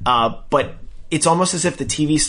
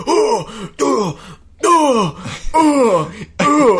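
high "oh" cries in rhythm; rhythmic mock wails; 10 semitones higher, 4 dB louder, much slower than usual. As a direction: imitate upset, angry outbursts on a musical beat. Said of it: "in, like, rhythm to the music".

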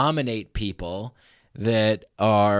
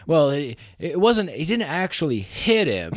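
put-on Southern lawyer accent; 6 semitones higher, 3 dB louder than usual. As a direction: play a slick Southern trial lawyer. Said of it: "it's like a Southern lawyer".

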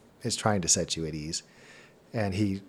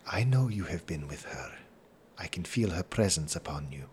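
hushed, lowered, knowing tone; low-voiced stranger; 4 semitones lower, 7 dB quieter than usual. Stranger senses her presence upon travellers, hushed low voice. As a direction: murmur it low and whispery to the travellers.

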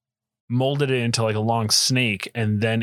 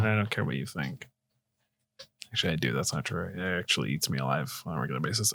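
moody mutter; 8 semitones lower, 9 dB quieter, faster than usual. Acted. Brooding [name] lyric bit.

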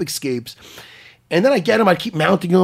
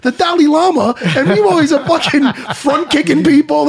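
much higher and louder, laughing mock outrage; teasing; 10 semitones higher, 5 dB louder than usual. Real, not acted. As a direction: rib your friend loudly through laughter.

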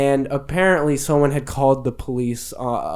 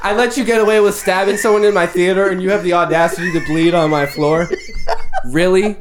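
mock exasperated Bods; 4 semitones higher than usual. Bods scolding Max for bleeding on his floor, fast exasperated rant. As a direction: rattle off the outrage in disbelief. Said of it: "Bods is like".

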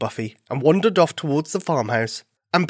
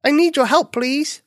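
high-pitched pleading bunny voice; little bunny, pleading; 12 semitones higher, 4 dB louder than usual. Acted. Bunny begging the farm dog for help, pleading.

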